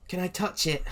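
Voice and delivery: put-on high, small child voice; shy little kid asking, high; timid child. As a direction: ask shyly like a little kid.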